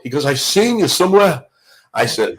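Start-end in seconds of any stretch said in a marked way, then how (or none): 0.2-1.4 [name] imitation. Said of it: "your [name] imitation".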